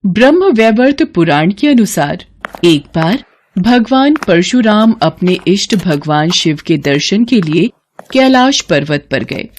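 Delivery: ordinary delivery, nothing marked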